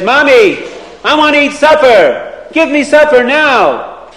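put-on bossy kid ordering his mother around; a boy rudely telling his mommy to give him supper now; rude demanding boy. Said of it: "how is he talking to his mother".

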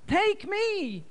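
very high pleading mother's voice; mother begs Nachmanson to take her, high and pleading; begging for mercy. A narrator's reading. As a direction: plead with the interrogator, voice way up. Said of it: "she begged with mercy".

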